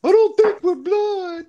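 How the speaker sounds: high, slow, wailing imitation; mock crying. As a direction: mimic a drunk teen sobbing it out.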